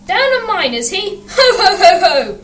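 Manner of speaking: high, booming mock guffaw of a big engine; laughing. A narrator's reading. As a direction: crow with loud ho-ho laughter. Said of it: "laughed Gordon".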